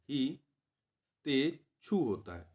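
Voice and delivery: हितेच्छु slowly as "hi... te... chhu"; syllable by syllable. Shown as a demonstration. Teacher spelling out the correct word, हितेच्छु slowly in syllables. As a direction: break हितेच्छु into separate soft syllables.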